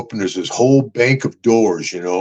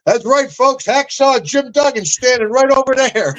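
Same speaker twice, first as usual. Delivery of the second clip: put-on announcing to the kids; 12 semitones higher than usual. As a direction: call it out like a showman pointing someone out. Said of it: "He turns around and goes".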